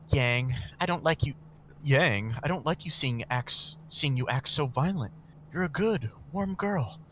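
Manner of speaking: gentle man. Acted. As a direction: gently scold her. Master gently scolds.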